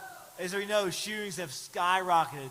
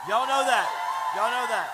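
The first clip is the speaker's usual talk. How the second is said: loud; 3 semitones higher, 6 dB louder than usual. Real, not acted. Rallying the crowd.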